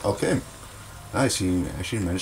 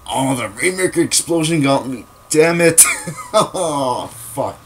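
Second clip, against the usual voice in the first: frustrated shout; 6 semitones higher, 5 dB louder, slower than usual. Real, not acted. Killed by explosion.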